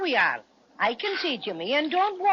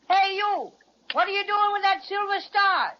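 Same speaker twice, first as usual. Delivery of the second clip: shouting; 6 semitones higher than usual. Acted. Bear demands the star back, shouting. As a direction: shout the question across the water.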